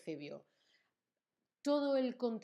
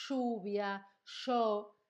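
Argentine sh sound; 3 dB louder, much slower than usual. Shown as a demonstration. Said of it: "si escuchas a alguien diciendo".

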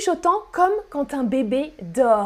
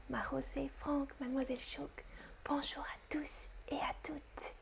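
hushed whispered greeting to the chat; very soft stage-free whisper; 20 dB quieter than usual. Shown as a demonstration. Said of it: "et je chuchote".

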